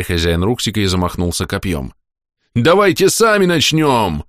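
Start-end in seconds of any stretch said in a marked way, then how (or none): 2.5-4.2 high, slow savage yell; rally the tribe, loud and drawn out; savage shouting. Acted. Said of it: "и кинул копьё".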